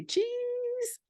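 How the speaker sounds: put-on high child's cheese; kid saying cheese. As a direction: chirp it like a little boy. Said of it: "he loves to say".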